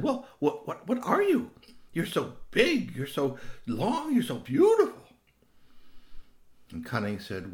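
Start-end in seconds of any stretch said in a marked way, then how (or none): 0.0-5.0 high, stammering owlet voice; wide-eyed wonder, pitched high; awed baby owl. Acted. Owlet marvels at the big stranger, high-pitched.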